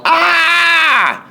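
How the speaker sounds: high piercing scream of pain; mother's shriek. A narrator's reading. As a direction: shriek as if bitten on the nose. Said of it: "She yelled".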